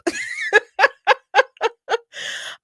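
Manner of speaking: a big, high burst of real laughter; loud high laugh. Real, not acted. Big laugh after the line.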